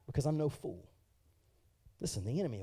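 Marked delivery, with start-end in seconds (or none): none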